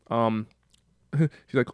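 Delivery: normal talking voice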